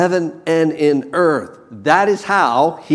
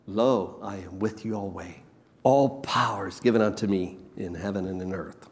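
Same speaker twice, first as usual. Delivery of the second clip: hushed quote; 6 semitones lower, 10 dB quieter than usual. Acted. Preacher quoting Jesus softly.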